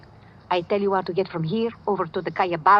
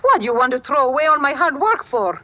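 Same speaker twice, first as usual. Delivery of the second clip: louder and higher, indignant; indignant outburst; 7 semitones higher, 5 dB louder than usual. Acted. Woman scolds the man about to leave, indignant outburst. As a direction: stop him from throwing it all away. She stops him from leaving.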